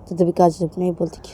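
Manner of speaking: ordinary delivery, nothing marked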